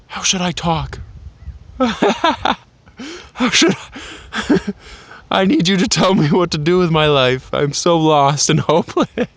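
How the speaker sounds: high-pitched voice breaking up with laughter; laughing. Real, not acted. Vlogger jokingly begging viewers for life advice, laughing. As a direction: crack up while faking a hopeless plea. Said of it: "I'm laughing because".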